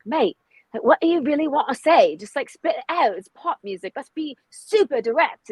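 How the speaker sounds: lightly voiced British producer, pushing; British accent imitation. Mimicking the British producer urging directness, light British accent. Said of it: "he's got his British accent".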